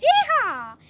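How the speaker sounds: high, loud yeehaw; cowboy whoop. Acted. Haman picturing his horse ride, whooping. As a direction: whoop it like a rider.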